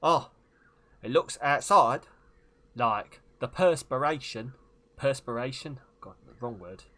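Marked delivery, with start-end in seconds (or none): 0.0-5.8 hammed-up celebrity voice with heavy pauses; Michael Caine. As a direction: punch each chunk of the phrase. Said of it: "Do a Michael Caine impression".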